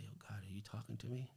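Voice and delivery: quick, near-whispered question; hushed aside to God. Retold or imitated. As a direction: reenact a private, awed question under the breath. Retold private question to God.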